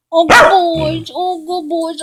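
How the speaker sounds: high, drawn-out cooing to dogs; stretched-out pet-owner coo; doting praise. Real, not acted. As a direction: gush over good dogs. Gushing over her dogs.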